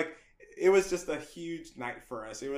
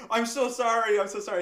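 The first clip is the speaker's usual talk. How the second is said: louder, higher repeated apology; frantic apology; 6 semitones higher, 6 dB louder than usual. Retold or imitated. New hire to line producer on the phone, frantically apologetic. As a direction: plead forgiveness in a rush of feeling.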